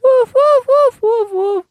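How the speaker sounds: very high, loud dog barks; squeaky little dog barking; high yappy woofs. Shown as a demonstration. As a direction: yap like a tiny dog, way up high. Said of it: "almost hearing a chihuahua".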